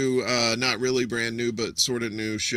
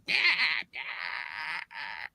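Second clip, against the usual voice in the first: tiny creature mewl; 4 semitones higher, 7 dB quieter than usual. Retold or imitated. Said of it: "the little creature thing".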